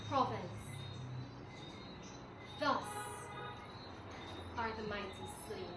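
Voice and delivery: ordinary delivery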